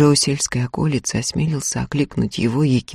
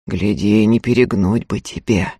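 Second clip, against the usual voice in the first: low warning; 7 semitones lower than usual. A narrator's reading. Prokop warns the landlady.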